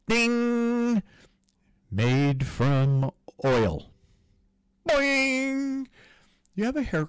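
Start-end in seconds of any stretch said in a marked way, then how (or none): 0.1-1.0 mouth-made high bell ding; high ding sound. Pretend. Sound effect while listing items. 4.9-5.9 high springy boing; cartoon boing. Sound effect while listing items.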